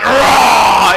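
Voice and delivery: loud mock roar, playing a monster; roaring at a little girl to scare her, loud mock monster. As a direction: roar at her like a pretend beast. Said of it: "and I went".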